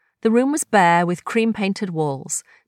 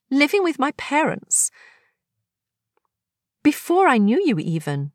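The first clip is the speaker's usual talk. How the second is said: higher voice, wistful surprise; Agnes, nostalgic; 7 semitones higher than usual. Acted. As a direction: recall a long-lost memory. Agnes recalling youth.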